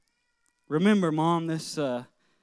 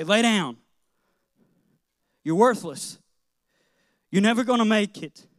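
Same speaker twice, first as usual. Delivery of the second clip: loud, higher mock scolding of a child; harsh father; 6 semitones higher, 9 dB louder than usual. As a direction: bark it like a cruel dad tearing a kid down. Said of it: "He wouldn't look at me and say".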